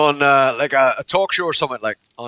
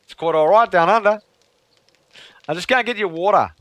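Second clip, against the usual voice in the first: higher-pitched mock-Australian accent; hammed-up Australian waitress, pitched up; 4 semitones higher than usual. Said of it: "tried to order water in Australia".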